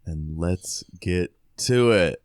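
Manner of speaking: ordinary talk, nothing marked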